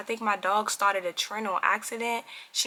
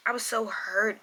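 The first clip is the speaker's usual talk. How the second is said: usual speaking voice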